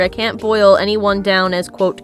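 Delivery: ordinary talk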